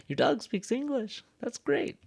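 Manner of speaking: high-pitched delighted disbelief; amazed reaction. Acted. Teacher reacts to a dog that speaks English, amazed.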